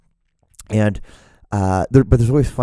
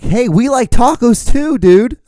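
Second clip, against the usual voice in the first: much higher, loud, slow goofy voice; mock clueless ally; 8 dB louder, slower than usual. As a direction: ham up an eager dudebro. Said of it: "Don't be like".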